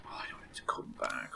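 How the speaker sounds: hushed, nervous whisper. Real, not acted. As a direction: whisper it, scared he'll hear. Scared of monster returning.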